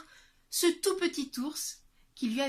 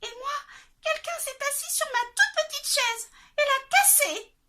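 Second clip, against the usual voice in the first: very high, squeaky little bear; high little bear complaining; tiny bear voice; 7 dB louder than usual. Acted. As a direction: whine like a tiny cub. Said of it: "se plaignit de sa toute petite voix".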